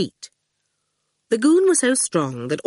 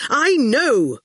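angry; 8 semitones higher, 4 dB louder than usual. A narrator's reading. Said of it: "Quentin said angrily".

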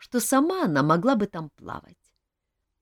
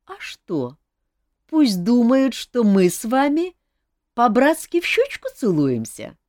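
sly; 6 semitones higher, 6 dB louder than usual. Acted. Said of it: "хитро сказала".

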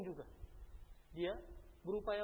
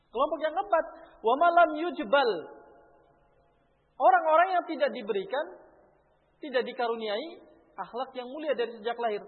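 fervent preaching; 8 semitones higher, 14 dB louder than usual. Acted. Preacher raising voice on point.

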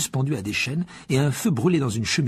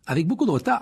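usual speaking voice

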